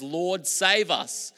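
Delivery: high, loud, slow psalm proclamation; preacher reading the psalm aloud; proclaiming the psalm. Acted. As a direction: declare the psalm out loud to the hall. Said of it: "Psalm a hundred and eighteen says".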